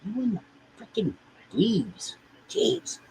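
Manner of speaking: high squeaky voice for the cat; high-pitched pet talk; cat baby talk. Acted. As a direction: coo at the kitty like a baby.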